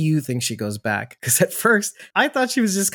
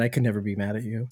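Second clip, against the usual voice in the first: softer, faster repeat of the line; quieter teenager voice; 6 semitones lower, 7 dB quieter, faster than usual. Retold or imitated. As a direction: say it again under your breath, quickly. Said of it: "is that [name]'s teenager voice".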